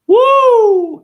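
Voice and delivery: long, loud, very high whoop; piercing high holler. Real, not acted. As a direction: let out a drawn-out falsetto "woo". Whoops after nightmare boast.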